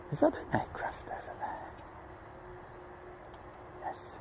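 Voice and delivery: very high, soft unvoiced whisper of a frightened bear; scared little voice. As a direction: whisper it like a timid teddy. Scared small voice in an aside.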